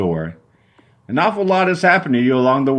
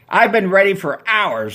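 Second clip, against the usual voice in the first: Susan screaming; 8 semitones higher than usual. Acted. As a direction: screech it at the boyfriend. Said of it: "she screams".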